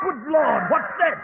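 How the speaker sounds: jolted by a scream from the woods; startled alarm. Acted. Watson hears a scream in the dark woods, startled cry.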